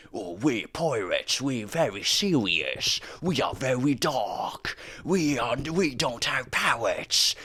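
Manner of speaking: high, drawn-out mocking voice; mock gritty pirate. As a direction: send up self-serious pirates, slow and high. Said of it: "grittier sorts of pirate media, you know, the whole".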